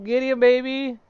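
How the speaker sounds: high, loud hyped call; excited shout. Real, not acted. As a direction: yell it out, pumped. Hyped calling out the boss.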